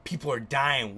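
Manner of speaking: ordinary delivery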